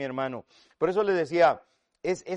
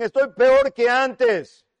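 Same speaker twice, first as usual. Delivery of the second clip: loud, high, drawn-out complaint; raised voice of a fed-up reader; exasperated reader; 7 semitones higher, 8 dB louder, much slower than usual. Acted. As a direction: stretch it out as a hammed-up lament.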